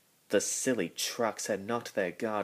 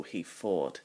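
plain reading voice

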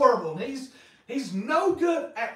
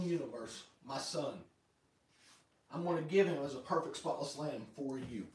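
tender voice of God; 6 semitones lower, 5 dB quieter than usual. Acted. God's tender promise.

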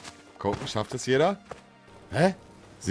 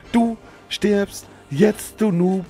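taunting; 8 semitones higher, slower than usual. Acted. Slow taunt.

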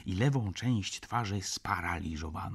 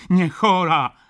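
very loud, high protest; indignant shout; 12 semitones higher, 15 dB louder, slower than usual. A narrator's reading. Baroness denying she is sick, shouting indignantly. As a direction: call out a sharp denial. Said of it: "zawołała baronowa".

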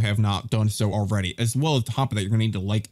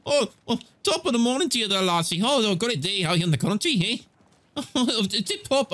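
high, hammed-up Irish accent; stage-Irish brogue, high and playful; put-on Irish; 9 semitones higher than usual. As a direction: greet them in a jolly Irish lilt, pitched high. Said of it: "I don't know why I turned Irish".

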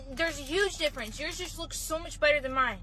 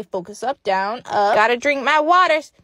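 normal talking voice

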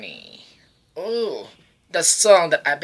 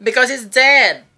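mock blunt answer; 7 semitones higher, 7 dB louder than usual. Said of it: "And he was like".

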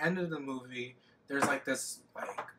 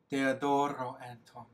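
put-on Maria's accent. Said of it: "she's all like".

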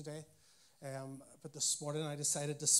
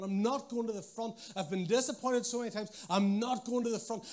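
stubborn refusal; 6 semitones higher, 5 dB louder than usual. Retold or imitated. Husband stubbornly refusing wife.

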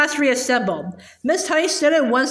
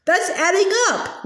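higher-pitched schoolboy's protest; crying out in protest; 6 semitones higher than usual. A narrator's reading. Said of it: "Wilfred cried".

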